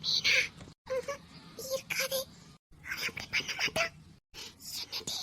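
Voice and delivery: hushed, whispered disbelief; shocked whisper. Acted. Woman cannot believe he would do it, shocked whisper. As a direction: whisper it, barely any voice.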